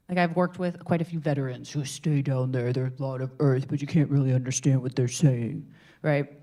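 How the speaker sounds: low, muddy earth voice; low grounded demo voice. Shown as a demonstration. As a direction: sink it down low and heavy. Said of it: "the downside of earth is that it can sound muddy".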